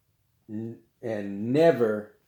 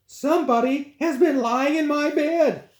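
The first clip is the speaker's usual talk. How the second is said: much higher mama bear voice; pitched-up mama bear; middle-sized voice; 4 dB louder than usual. Acted. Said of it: "in her middle-sized voice".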